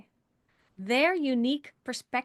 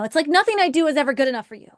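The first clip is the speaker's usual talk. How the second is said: fast, higher, louder grievance; acted partner's complaint; 4 semitones higher, 5 dB louder, much faster than usual. Partner complains nothing is ever good enough, acted aggrieved. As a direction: blurt it out like a wounded accusation. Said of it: "if your partner says".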